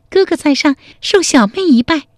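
put-on a clever girl sealing the deal with a bow; sweet young woman. A girl bows to her new big brother, high and sly.

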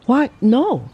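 taken aback, lightly voiced; surprised exclamation. A narrator's reading. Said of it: "exclaimed the girl in surprise".

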